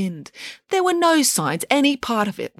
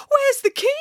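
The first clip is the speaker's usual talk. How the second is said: very high, anxious question; high-pitched storybook creature; little chicken. A narrator's reading. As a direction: ask it in a small chicken's squeak.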